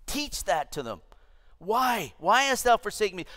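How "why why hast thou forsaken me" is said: high-pitched, urgent questioning; impassioned plea. Acted. Preacher voicing Jesus asking God why, high pleading.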